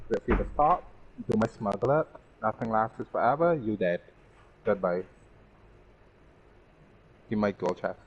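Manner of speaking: usual speaking voice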